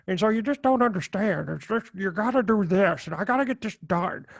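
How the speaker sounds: odd put-on movie accent; Nicolas Cage. Podcaster imitating an actor's random accent, mock accent. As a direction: slip into a strange, inexplicable accent. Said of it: "he starts talking like this".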